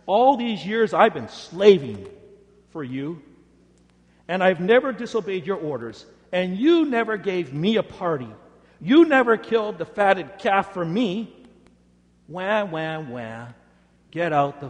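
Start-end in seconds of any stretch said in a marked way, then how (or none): 0.1-11.3 loud, bitter complaint, voice raised high; the elder son shouting at his father; resentful outburst. Acted. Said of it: "this rude outburst". 12.3-13.5 a hammed-up whiny baby wail; mock him with a fake wah-wah; teasing sob noise; mock crying wah. Mocking the son's whining.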